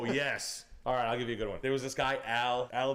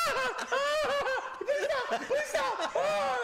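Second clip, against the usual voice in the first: very high, girlish mock wailing; helpless crying laughter; 4 dB louder, much slower than usual. Boss under his desk laughing too hard, high mock crying. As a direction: imitate someone begging through hysterical laughter, high-pitched. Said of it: "crying like a girl".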